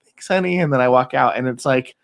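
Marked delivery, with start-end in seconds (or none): 0.0-0.6 high, light sugary thanks; lightly voiced happy wife; sweet wife. Husband voicing wife's thanks, high and sweet. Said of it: "she goes".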